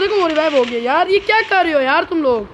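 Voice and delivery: high, loud mid-fight shouting; frantic squad callouts. Real, not acted. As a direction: urgently yell directions at your teammates. (घबराहट भरे जोश में ज़ोर से)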